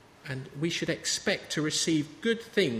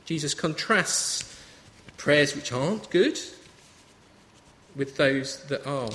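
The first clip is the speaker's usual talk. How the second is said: ordinary talk, nothing marked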